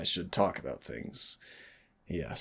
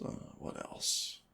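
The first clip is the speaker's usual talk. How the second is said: whispering; 8 dB quieter, slower than usual. Acted. Whispered musing aside.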